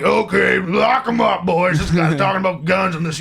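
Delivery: loud, high mock shout; cop role. Cop ordering the boys to arrest him, mock shout. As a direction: bellow orders like a cartoon cop. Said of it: "he would've been like".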